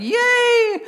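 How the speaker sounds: very high, sustained mock cheer; high-pitched crowd celebration, acted. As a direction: whoop it up like a jubilant throng. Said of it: "the throng is like".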